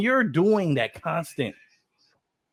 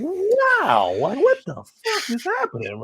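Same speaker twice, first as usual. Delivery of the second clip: high-pitched, astonished wow; acted surprise; 10 semitones higher, slower than usual. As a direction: act stunned by what you just heard. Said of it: "you're being surprised".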